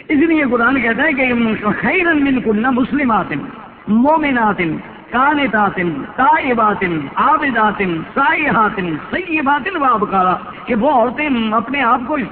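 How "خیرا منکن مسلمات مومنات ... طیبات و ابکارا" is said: louder, slow recitation of the verse; emphatic recitation. Acted. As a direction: drive each word home slowly, like a preacher. (زور دار اور آہستہ تلاوت)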